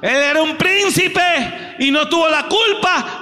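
shouted, high, rising sermon cry; fiery preacher exhorting the congregation, shouting; preaching at full force. Acted. Preaching climax.

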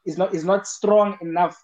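normal talking voice

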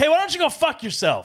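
high mock snap, retold; retelling a snappy comeback to the lady, high and mock; snapping back. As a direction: fire it back at her, mock snippy. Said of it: "and I just snapped".

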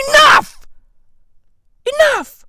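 high, loud cry of enough; exasperated outcry. Real, not acted. Fed-up man to his nation about hookah, exasperated shout. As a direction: shout it high to put a stop to it. Rant against hookah epidemic.

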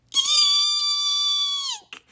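very high, slow squeal; a big lion letting out a tiny eek; slow, very high frightened squeak. A narrator's reading.

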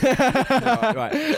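high laughing restart; giggling through the words. Real, not acted.

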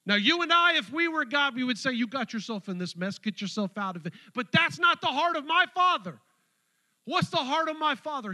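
loud, high, impassioned preaching; preaching at full force. Acted. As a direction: preach it out with fire.

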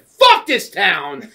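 loud, drawn-out defiant shout; slow re-enacted bellow of disgust; mad. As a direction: curse the whole town as you storm off. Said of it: "I was so mad, dude".

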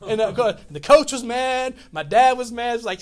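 ordinary delivery

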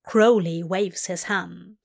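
ordinary delivery, nothing marked

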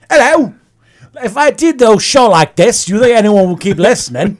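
hammed-up British host; a put-on British show voice; mock British accent. Host asks the guest in a mock British accent whether listeners would stay. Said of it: "I'm gonna do this the entire show".

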